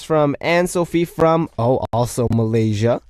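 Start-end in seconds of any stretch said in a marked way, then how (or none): none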